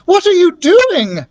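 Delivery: alarmed cry. Acted. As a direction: shout in alarm at the egg in the fire. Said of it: "I cried".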